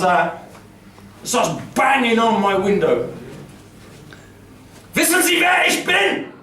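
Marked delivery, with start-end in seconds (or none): 5.0-6.3 furious German shout. Retold or imitated. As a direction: yell it like an outraged cop. Furious policeman bangs on window.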